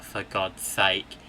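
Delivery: usual speaking voice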